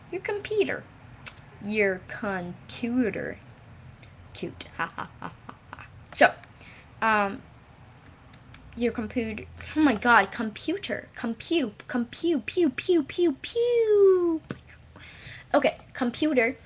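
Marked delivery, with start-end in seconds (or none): 0.1-0.8 squeaky high computer; high-pitched take. Shown as a demonstration. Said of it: "Now this sounds all weird". 1.6-3.4 stretched slow your computer; slow drawn-out take. Shown as a demonstration. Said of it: "Now this sounds all weird". 12.5-14.4 laser pew pew. Pretend. Playful laser noises.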